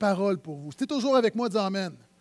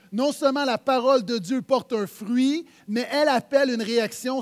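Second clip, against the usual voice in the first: loud, driving pulpit delivery; forceful preaching; 3 semitones higher, 6 dB louder than usual. Acted. Preacher telling the church God never speaks for nothing, forceful. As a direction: hammer it home to the congregation.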